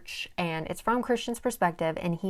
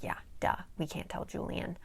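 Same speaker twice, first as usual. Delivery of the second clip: quiet, dry, mock-obvious echo; sarcastic duh; 8 dB quieter than usual. Daisy mocking Declan's obvious warning, dry and quiet. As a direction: toss it back like it's obvious, softer. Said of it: "She's like".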